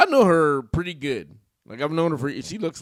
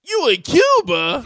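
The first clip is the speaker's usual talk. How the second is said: high, loud, drawn-out disbelief; hammed-up incredulous yelp; shocked mom; 10 semitones higher, 7 dB louder, slower than usual. Mom learning on the phone where her child is, shocked.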